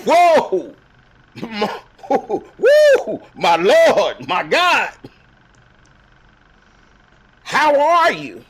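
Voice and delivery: whoa rising high and loud, a laugh breaking through, then a slow high how are you; stunned amazement. Acted. Doubting Tommy sees the holes in Jesus's hands, high, loud, astonished. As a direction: gasp it out like you can't believe your eyes.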